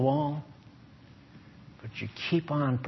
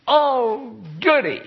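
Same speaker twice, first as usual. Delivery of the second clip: high, whiny mock pout; 7 semitones higher, 6 dB louder, slower than usual. Preacher mocking a believer who wanted directions, whiny pout. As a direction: whine it like a sulking child.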